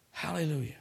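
ordinary delivery

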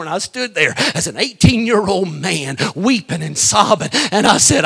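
usual speaking voice

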